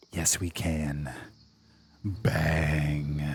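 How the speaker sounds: low, drawn-out payoff line; low triumphant call. Real, not acted. Streamer answering his own question as the eagle putt drops, low and triumphant. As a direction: drop low and savor the winning moment.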